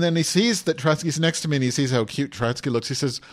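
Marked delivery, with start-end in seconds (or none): none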